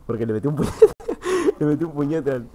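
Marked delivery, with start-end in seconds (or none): 1.0-1.5 short high-pitched laugh. Real, not acted. Streamer recounting a punch to the monitor, sudden high laugh. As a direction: burst into a quick high laugh.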